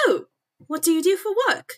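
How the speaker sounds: high, quick posh British lady; posh British accent. Acted. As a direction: ask it in a lofty posh British voice, loud and fast. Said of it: "the fucking posh British accent".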